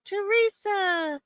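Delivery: playful squeaky greeting; high sing-song name. Pretend. Greeting a viewer.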